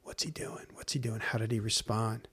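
hushed, unvoiced whisper; whispering demons. Demons whispering to each other about the believer. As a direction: breathe it like a plotting demon.

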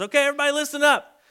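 the loud call for attention; calling out to a crowd. Retold or imitated. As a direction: gather a whole crowd with it.

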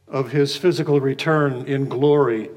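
ordinary delivery

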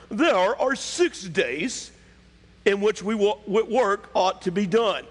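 high, drawn-out pompous scolding; indignant ruler's tone. Acted. Synagogue ruler scolding the people for seeking healing, indignant. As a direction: lecture them with haughty, stretched-out indignation. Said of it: "he said it in this tone".

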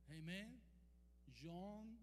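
normal talking voice

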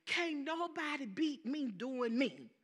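ordinary delivery

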